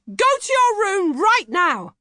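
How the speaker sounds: high, loud, slow mock shout of an angry mum. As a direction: bellow it as the fed-up mother. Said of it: "she lost her rag and shouted at me".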